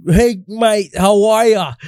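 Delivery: broad Australian greeting; Aussie accent, high and bright. Retold or imitated. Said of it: "Aussie, Aussie accent".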